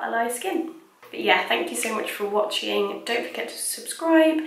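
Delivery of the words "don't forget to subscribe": high playful whisper. Real, not acted. Playful sign-off aside.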